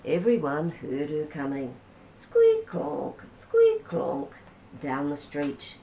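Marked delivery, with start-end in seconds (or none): 2.1-4.3 high squeaky squeak, then a clonk; playful squeak-clonk sound effect; squeaky bike noise. A narrator's reading. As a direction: voice the wonky pedals, squeak high.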